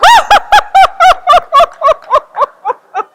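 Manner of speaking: very high shrieking laugh; loud laugh. Real, not acted. Teller cracking up at the joke, loud high laugh. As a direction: burst out laughing.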